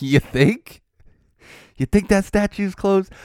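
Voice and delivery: sarcastic "no kidding" disbelief; mock incredulous. Mocking the map's closed notice.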